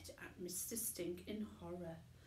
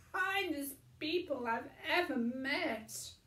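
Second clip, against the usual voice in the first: higher, louder praise; fond Mister Stink; 8 semitones higher, 8 dB louder than usual. A narrator's reading. Tramp praising Chloe to the TV audience, higher and louder. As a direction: sing the girl's praises.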